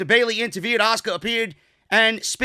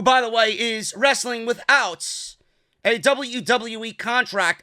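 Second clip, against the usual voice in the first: usual speaking voice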